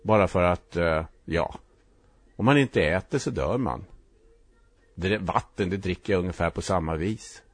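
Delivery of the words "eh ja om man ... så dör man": ordinary delivery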